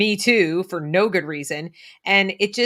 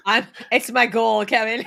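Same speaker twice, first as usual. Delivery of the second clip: laughing; 5 semitones higher, 5 dB louder than usual. Real, not acted. Laughing at joke.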